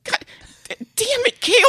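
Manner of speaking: high, loud exasperated outburst; exasperated yell. Acted. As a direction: curse at your co-host, voice jumping up. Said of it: "I'm sorry I yelled at you".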